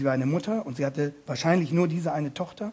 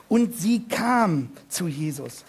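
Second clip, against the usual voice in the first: slow, weighty reading with Und hit hard; stress on Und; slower than usual. Shown as a demonstration. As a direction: lean into „Und sie kam zu Jesus“, slowly. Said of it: "das ist ganz wichtig". (langsam und betont, Betonung auf „Und“)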